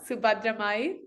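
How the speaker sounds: ordinary talk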